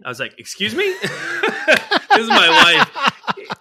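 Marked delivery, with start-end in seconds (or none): 0.4-0.9 indignant, high-pitched retort; high, incredulous question; mock taken aback. Acted shocked reply to wife's remark. 1.0-3.5 helpless laughter through the words; high, loud, laughing aside. Real, not acted. Cracking up at his own story.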